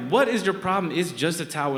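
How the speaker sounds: put-on snapping back at his girlfriend over a towel; exasperated shout. Boyfriend yelling at girlfriend over a towel, exasperated.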